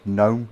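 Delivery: gnome said like garden gnome, NOME; silent G. Shown as a demonstration. Streamer insisting on the name, gnome with a silent G. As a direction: say gnome without any G. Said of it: "As in garden gnome".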